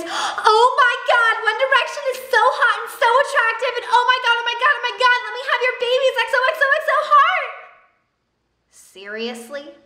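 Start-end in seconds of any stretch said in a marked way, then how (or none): 0.0-7.5 high, squealing mock fangirl; breathless mock fangirl with a giggle at the end; gushing fangirl. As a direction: mimic a swooning teen fan, much higher. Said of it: "half of their fans are fans because".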